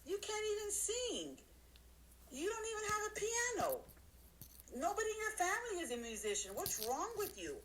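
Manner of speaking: high mocking voice of a doubter; mocking naysayer. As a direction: sneer it like a scornful hater. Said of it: "They might say".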